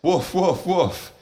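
high, bark it out like a small dog; a mouse faking a dog's bark. A narrator's reading. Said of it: "one of the mice suddenly shouts".

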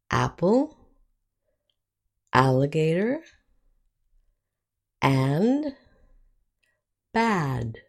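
Apple, alligator, and, bad, each with the short "a"; short A examples. Shown as a demonstration. Teacher giving words with the short A, careful modelling. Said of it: "The short sound is".

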